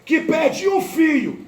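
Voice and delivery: high, slow, swelling preacher's voice; preacher pleading for the grieving mother; impassioned preaching. Acted. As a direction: drive each phrase home to the church.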